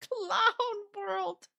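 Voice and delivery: slow words dissolving into laughter; amused, laughing. Real, not acted. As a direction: laugh your way through it, drawing it out. Laughs at absurd situation.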